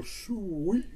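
high, drawn-out sweet; older lady savoring. Retold or imitated. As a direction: coo it like the church ladies. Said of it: "they'd go".

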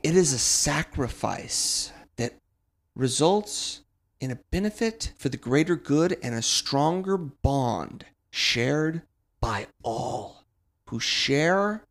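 slow, grand preaching voice; solemn sermon. Acted. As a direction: preach it slowly like scripture. Solemn wrestling sermon closer.

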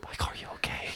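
soft breathy whisper of concern; mock worried whisper. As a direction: whisper it like a fussing friend. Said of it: "and go".

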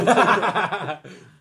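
loud burst of laughing; loud laughter. Real, not acted. Friends laughing at the spelling game, loud laughter. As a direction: crack up loudly.

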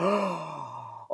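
mock shocked intake of breath; horrified gasp. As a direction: gasp as if watching a grim kill. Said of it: "and you're like".

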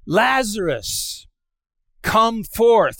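loud, high, drawn-out summons; calling out. Acted. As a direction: summon someone out of a tomb. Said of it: "he calls out".

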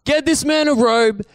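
high-pitched, stretched-out calling shout; shouting back. Retold or imitated. Father orders servants to celebrate his son, shouting back. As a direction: call out to someone far across the yard. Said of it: "he just shouts back to his house".

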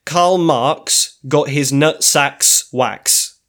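slow, careful read of a silly line; slow deliberate reading. Shown as a demonstration. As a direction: read out slowly, word by word. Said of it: "the next Bohemian Rhapsody goes as follows".

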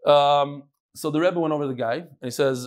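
usual speaking voice

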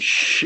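drawn-out acted shush; long hushing "shh". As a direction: imitate shushing someone. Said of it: "I'm like, like this".

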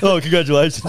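laughing imitation of sarcastic congratulations; mock sarcastic. Co-host mimicking the host's congratulations, mock sarcastic. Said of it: "That sounded so sarcastic".

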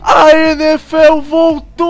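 stretched, shouted sportscaster cry; touchdown call. Pretend. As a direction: drag every letter out like a goal call. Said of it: "obra-prima de narração". (grito de narrador esportivo arrastado)

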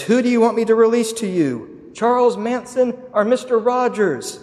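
loud, high imitation of an official addressing a mob; governor calling out. Governor offering the mob two prisoners, loud proclaimed question. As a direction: call the question out over a crowd.

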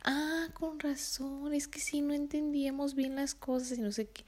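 mock dawning realization; client realizing. Client finally understands in meeting.

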